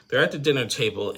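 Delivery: ordinary delivery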